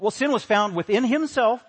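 loud, high, driving the quote home; emphatic declaration. Shown as a demonstration. Preacher to congregation, loud emphatic quote. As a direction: proclaim it from the pulpit.

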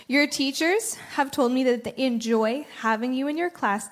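ordinary delivery

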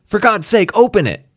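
high, loud pleading yell; desperate shout. Acted. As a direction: beg at full voice. Kramer pleading to open hatch.

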